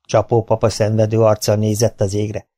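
ordinary delivery, nothing marked

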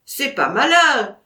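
loud indignant cry; cried out. Acted. Vatrin to the narrator about the dog, crying out loud. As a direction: shout it out in vexation. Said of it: "s'écria Vatrin".

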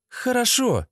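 high-pitched female voice; woman agreeing. Acted. Lena consents to Sasha's plan, high-voiced assent.

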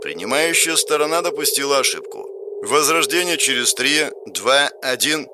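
measured countdown, stretched at the end; system announcer. Acted. Game system counts down to rebirth, measured announcer voice.

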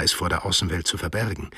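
ordinary delivery, nothing marked

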